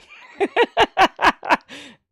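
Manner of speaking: big high burst of laughter; loud laugh. Real, not acted. Vlogger laughing hard at the girlfriend mix-up, loud laugh. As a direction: really laugh out loud at the surprise.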